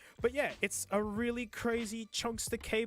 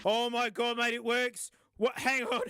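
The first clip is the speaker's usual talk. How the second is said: louder, rising shock that it works; real surprise; 4 semitones higher, 4 dB louder than usual. Real, not acted. Vlogger finds the cheap cable charges, excited and louder. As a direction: gasp it out as it charges.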